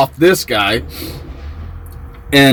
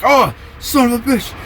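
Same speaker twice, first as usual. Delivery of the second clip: high-pitched mock cursing; imitated cry of dismay; mock outcry; 8 semitones higher, 4 dB quieter than usual. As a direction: curse in a high, put-on voice. Acted outcry of scalded man.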